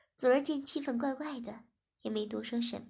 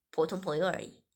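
lower, steady man's voice for 文轩; male lead's voice; 5 semitones lower than usual. Acted. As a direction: speak as the calm young boss. Narrator voices male lead. (低沉的男主角声音)

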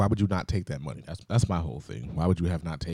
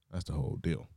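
usual speaking voice

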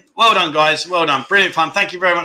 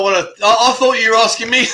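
ordinary delivery

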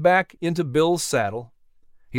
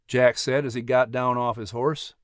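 ordinary delivery, nothing marked

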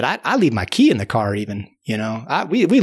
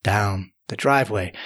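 ordinary delivery

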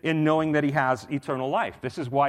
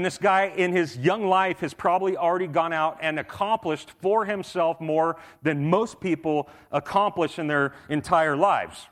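usual speaking voice

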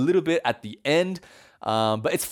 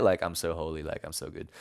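low, trailing-off self-congratulation; quiet, low-pitched humblebrag; smug aside; 11 semitones lower, 8 dB quieter, faster than usual. Acted. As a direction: tuck it under the breath as a self-satisfied aside. Peter's smug aside, retold.

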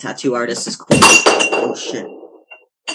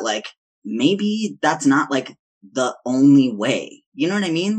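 ordinary talk, nothing marked

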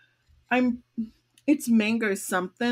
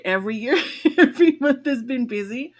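laughing it out, higher and louder; 4 semitones higher, 8 dB louder, faster than usual. Real, not acted. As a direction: burst out laughing at how busy it's been. Laughing admission about busy year.

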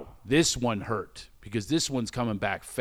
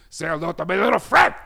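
loud, higher movie-gangster impression; Scarface; 5 semitones higher, 6 dB louder than usual. Last one in the room, as a shouting gangster impression. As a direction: yell it as a cornered film gangster. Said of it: "It's like Scarface".

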